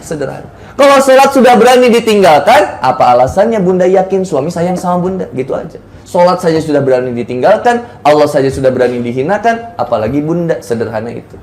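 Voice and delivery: ordinary talk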